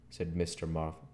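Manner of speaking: plain reading voice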